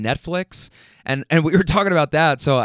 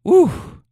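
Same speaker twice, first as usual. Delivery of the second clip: heavy breath, then high ooh; winded exhale; 13 semitones higher, 7 dB louder than usual. Retold or imitated. Winded husband after shutting the door, imitated puff and whoop. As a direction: act out recovering from exertion. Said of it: "still trying to catch his breath".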